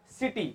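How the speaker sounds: सिटी opening on an S sound; soft C. Shown as a demonstration. Teacher modelling C before I, सिटी with an S sound. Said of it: "तो क्या बनेगा?".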